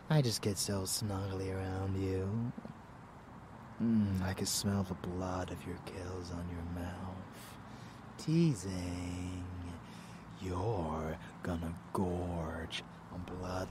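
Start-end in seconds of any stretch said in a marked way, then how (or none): none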